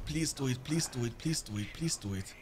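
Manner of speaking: hushed pleading to his units; fading plea. Real, not acted. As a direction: beg it under your breath, trailing away.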